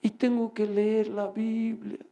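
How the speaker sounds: slow, drawn-out tired complaint; put-on weariness. Talking so Satan will hear how tired he is, weary. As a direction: drag it out as if worn out. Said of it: "y hablando así".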